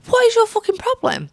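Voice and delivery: mock outrage. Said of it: "And I was like".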